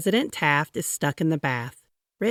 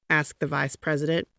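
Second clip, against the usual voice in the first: ordinary delivery, nothing marked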